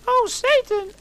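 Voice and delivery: extremely high, loud falsetto cry; hammed-up yell. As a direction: squeal it over the top. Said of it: "yelled Harry in pleasure".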